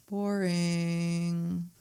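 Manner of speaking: soft, drawn-out monotone; bored verdict. Acted. Viewer judging the new Halloween, bored. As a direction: drone it on one long note, unimpressed.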